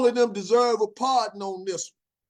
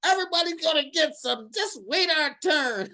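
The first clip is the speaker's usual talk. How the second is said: high, slowing prim woman voice; lightly voiced prissy lady; mock scolding lady; 8 semitones higher than usual. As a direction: chide like a fussy bystander. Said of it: "this other lady behind her was like".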